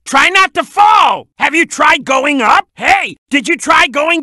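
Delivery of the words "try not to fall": loud, slow, drawn-out warning call; raised-voice game warning; urgent warning. Acted. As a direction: call it out to someone near a drop. Warning player of a drop.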